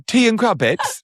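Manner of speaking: very high, rising mock British voice; British accent. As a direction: ask it like a prim English lady. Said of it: "say everything British we can think".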